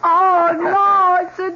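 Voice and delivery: delighted surprise. Acted. Wife discovers the dog her husband brought, squealing with delight.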